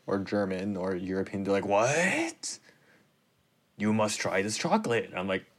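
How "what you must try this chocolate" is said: put-on light Swiss accent, incredulous; mock Swiss colleague, surprised. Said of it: "very good impression of a Swiss person".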